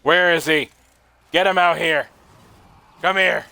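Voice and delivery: high, loud combat calls; combat shouting. Real, not acted. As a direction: shout commands in the heat of a fight. Hunting an enemy in game combat.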